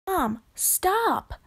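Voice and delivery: high-pitched urgent cry. A narrator's reading. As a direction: call out to make mom stop. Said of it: "cried Lainey".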